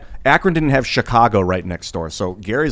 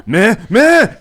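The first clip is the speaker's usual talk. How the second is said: high, loud laughing meh meh; mock the angry man's yell with a laugh; mocking blah blah; 12 semitones higher, 10 dB louder than usual. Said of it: "the guy was like".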